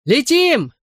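high, jubilant cry; calling out. A narrator's reading. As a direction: launch them with a high call. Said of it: "кричал ему Бен".

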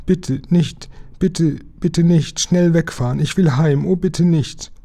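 high, anxious pleading; scared girl begging; frightened stammering. A narrator's reading. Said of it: "wobei sie stammelte".

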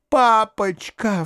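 high, drawn-out call to papa; high-pitched child's appeal; girl's impassioned cry. A narrator's reading. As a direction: cry out to father, stretching the word. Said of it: "вскричала Саша".

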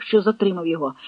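ordinary delivery

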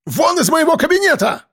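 very high, outraged order; high-pitched panicky outrage; slightly hysterical cry. A narrator's reading. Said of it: "громко и немного истерично".

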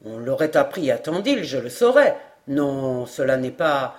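low-pitched, firm denial; deep, definitive answer; categorical. A narrator's reading. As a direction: rule it out in the sergeant's low voice. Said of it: "cette réponse formelle".

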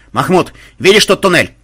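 excited shouted question; sudden yell. A narrator's reading. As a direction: yell it to a comrade mid-battle. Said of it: "неожиданно завопил Банзай".

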